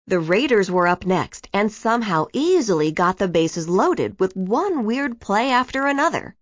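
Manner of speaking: plain reading voice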